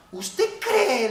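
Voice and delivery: high, loud mock disbelief; incredulous retort. Brother retelling his challenge to pastor, incredulous.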